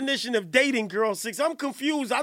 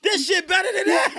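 laughing and yelling at once, higher and louder; laughing shout; 4 semitones higher, 4 dB louder than usual. Real, not acted. Host yells to the chat, laughing at the drama. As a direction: shout it while cracking up.

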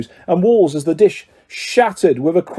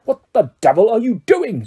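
higher, tense growl of anger; through gritted teeth; 5 semitones higher than usual. A narrator's reading. Uncle confronts Harry in his room, through gritted teeth. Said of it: "through gritted teeth".

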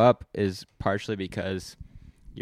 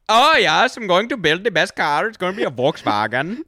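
slow, much higher, loud hammed-up Indian German accent; 7 semitones higher, 7 dB louder, slower than usual. Bragging about building a car, mock Indian German accent. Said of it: "It was like Indian German".

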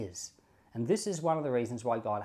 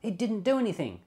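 higher, whiny objection about fairness; mock complaint; 7 semitones higher than usual. A listener protesting the fallen domino, mock complaint. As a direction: protest that it's unfair, pitched up. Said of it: "you say".

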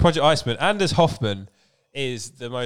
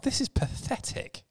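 mock disgust; 11 semitones higher, 8 dB quieter than usual. Real, not acted.

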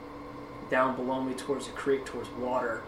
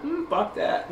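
a deer's scornful grunt, pitched much higher; 11 semitones higher, slower than usual. Retold or imitated. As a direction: voice the deer brushing you off. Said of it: "And he was like".

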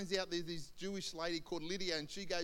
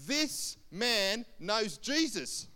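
slow, loud, word-by-word proclamation; imitating a mocking shopkeeper; mocking announcement; 4 semitones higher, 6 dB louder, much slower than usual. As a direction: announce it to a full shop, drawn out. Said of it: "he's a real mocking kind of guy".

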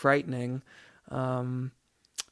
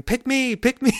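much higher, louder mock cry; playful shout; 10 semitones higher, 7 dB louder than usual. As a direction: pop out of the clothes rack and yell it. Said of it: "start shouting".

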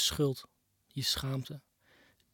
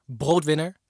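ordinary talk

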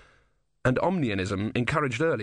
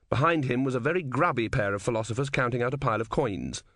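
usual speaking voice

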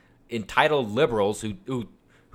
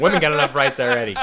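laughing; 4 semitones higher, 5 dB louder, faster than usual. Real, not acted. Joking while laughing.

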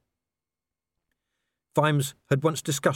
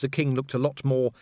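usual speaking voice

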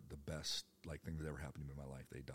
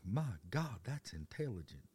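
higher, amazed Arkansas twang; impressed Arkansas locals; 4 semitones higher, slower than usual. Retold or imitated. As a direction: marvel in a mock Arkansas accent. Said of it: "people were like".

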